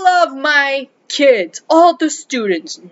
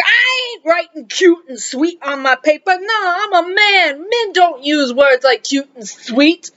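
put-on puffed-up macho boy; 5 semitones higher than usual. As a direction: play the boy puffing himself up as a man. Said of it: "they all just kind of puff up".

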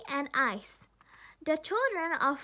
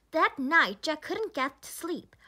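ordinary delivery, nothing marked

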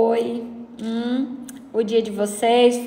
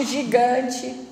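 laughing; 3 dB quieter than usual. Real, not acted. Laughing at giant glove.

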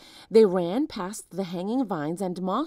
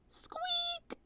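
tiny whispered squeak; mouse squeak; 11 dB quieter than usual. A narrator's reading.

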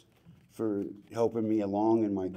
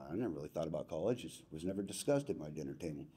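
quick, low-volume mumble, much softer; mumbled young self; 5 semitones lower, 10 dB quieter, faster than usual. Retold or imitated. Retelling his teenage reply to the coach, quick soft mumble. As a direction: toss it off softly like a clueless teen. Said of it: "I go".

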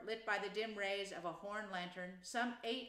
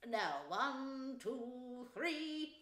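high count-in for the choir; small choir leader giving the cue; elder field mouse; 4 semitones higher, much slower than usual. A narrator's reading. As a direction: count off in a little mouse voice, drawn out. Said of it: "one of the elder ones that carried the lantern was just saying".

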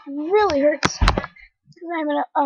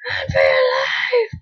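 panicked yell; 10 semitones higher than usual. Real, not acted. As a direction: shout a warning in real fear. Fleeing a mob in panic.

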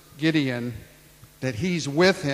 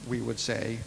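normal talking voice

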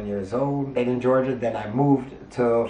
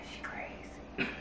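hushed whisper, slow; whispered aside; 13 dB quieter, slower than usual. Retold or imitated. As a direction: breathe it out under the breath. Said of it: "I just look at him and be like".

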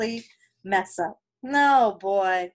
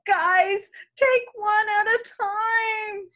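calling out; 8 semitones higher, slower than usual. Acted. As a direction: call to the group to go one at a time. Character calls to friends.

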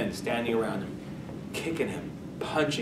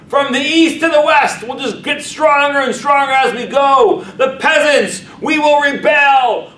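starts plain, swells to a far higher, louder shout; building to rallying shout; 11 semitones higher, 10 dB louder than usual. Acted. Prince urging the Siberian peasants to revolt, building to a shout. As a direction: whip the crowd from talk into a roaring battle cry.

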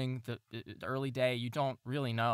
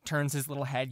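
usual speaking voice

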